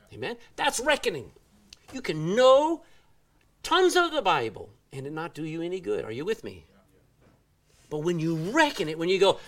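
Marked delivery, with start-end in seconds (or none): none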